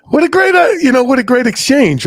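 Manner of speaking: very high, excited marveling; delighted exclamation. Retold or imitated. Recalling the kind exchange at the checkout, high excited delight.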